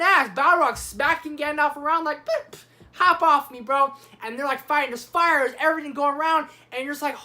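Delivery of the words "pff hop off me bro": dismissive taunt. Acted. Balrog swatting Gandalf in acted fight.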